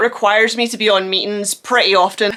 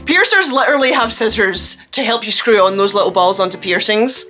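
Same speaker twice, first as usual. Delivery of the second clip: ordinary delivery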